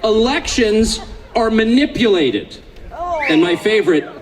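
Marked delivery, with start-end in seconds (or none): 0.0-2.6 slow, deliberate reading, every word spaced out; read slowly. Shown as a demonstration. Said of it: "I want to read this slowly".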